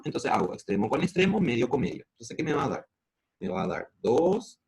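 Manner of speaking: normal talking voice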